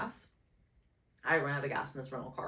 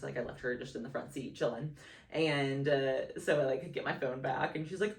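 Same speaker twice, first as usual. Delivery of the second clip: ordinary talk, nothing marked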